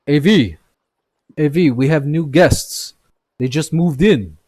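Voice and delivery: shouting to someone in the back, high and loud; calling out. Acted. Blacksmith shouting to his partner at the forge. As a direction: call across the forge to a coworker. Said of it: "he, he says".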